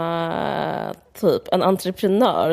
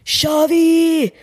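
loud, high party yell; mock shout; 10 semitones higher, 5 dB louder than usual. A Brett guest at the dinner, screaming the toast. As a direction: bellow it like a drunk reveller at a long table. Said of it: "bara skriker".

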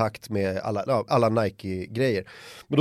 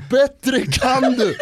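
much higher, louder, slow heckle; mock him from the crowd; kid yelling taunt; 11 semitones higher, 6 dB louder, much slower than usual. (hånfullt skrik)

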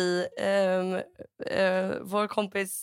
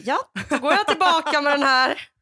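raised, higher voice; indignant retelling; 6 semitones higher, 6 dB louder than usual. Retold or imitated. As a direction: act out marching back to complain. Acted indignant return to shop.

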